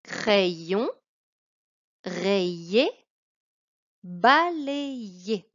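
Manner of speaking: careful model reading of a word list; reading aloud. Shown as a demonstration. Teacher reading word list to pupil. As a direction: read each word slowly and clearly. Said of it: "Je les lis ensuite avec toi".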